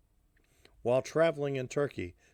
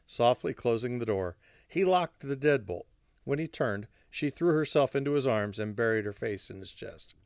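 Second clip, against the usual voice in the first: ordinary delivery, nothing marked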